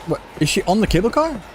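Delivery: ordinary talk, nothing marked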